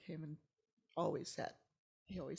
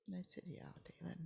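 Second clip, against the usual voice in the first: soft unvoiced "Yeah, David"; quiet weary reply; 2 semitones higher, 8 dB quieter, much faster than usual. [name] answering David, whispered. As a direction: barely breathe the answer.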